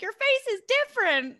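high-pitched retold astonishment at the baby; mock amazement. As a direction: exclaim it high, like an amazed mother.

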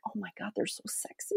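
hushed, breathy aside as a swooning listener; awed whisper, very soft. Acted. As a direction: whisper it like a smitten admirer.

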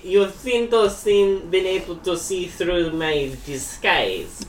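slow, halting attempted German accent; Werner Herzog. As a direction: feel through it slowly in a German accent. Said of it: "do Werner Herzog".

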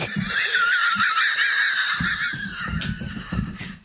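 very high, wheezing laughing fit; helpless laughter. Real, not acted. Storyteller cracking up over his friend's mom, helpless laughter. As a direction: lose it completely, long high peals.

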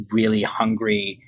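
usual speaking voice

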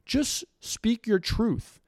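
high, slow, mocking parroting of a slogan; mocking quote. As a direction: drag it out high to mock the cliché. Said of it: "this idiotic culture of".

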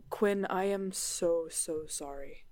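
slow, softening apology; hushed, sincere regret; heartfelt apology. Acted. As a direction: apologise gently, letting the voice drop away. Character apologises to friend after danger.

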